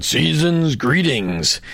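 slow, drawn-out stock greeting; mock card greeting. Host quoting a generic Christmas card, slow mock greeting. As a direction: stretch it out like an empty pleasantry.

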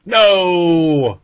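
loud high mock cry; dramatic no. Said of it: "I added the sound effects".

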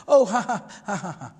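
imitated forced laugh, loud start falling away; awkward laugh. Umar breaking the tension, imitated awkward laugh. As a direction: fake an uneasy laugh that drops off. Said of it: "he laughs kind of awkwardly to break the tension".

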